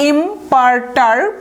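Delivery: ইমপারটার্ব said slowly in separate syllables; slow, segmented ইমপারটার্ব for learners; slow pronunciation demo. Shown as a demonstration. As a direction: sound out ইমপারটার্ব bit by bit, then stop. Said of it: "তাহলে আমরা উচ্চারণ করব কি?".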